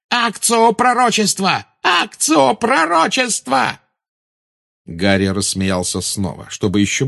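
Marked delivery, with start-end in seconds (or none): 0.1-1.7 high commanding cry of "Акцио"; shouted spell. Acted. Bellatrix casting summoning spell. 1.8-3.8 even higher, slow, stretched "Акцио пророчества"; drawn-out shriek. Acted. Repeated spell, more desperate.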